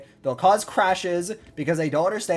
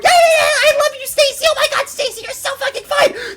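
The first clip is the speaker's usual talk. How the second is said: very high, squealing falsetto; mock smitten phone caller; 6 dB louder than usual. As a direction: gush lovestruck into the phone, hammed up. Mocking someone gushing on phone.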